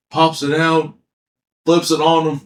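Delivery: usual speaking voice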